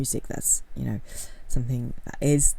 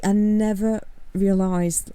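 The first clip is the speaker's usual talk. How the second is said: usual speaking voice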